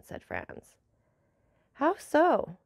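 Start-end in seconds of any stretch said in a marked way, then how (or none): 1.7-2.6 higher, louder surprised question. A narrator's reading. Count questions Franz's idea of simplifying the negotiation, surprised. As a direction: ask it with raised, puzzled surprise. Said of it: "returned the Count with surprise".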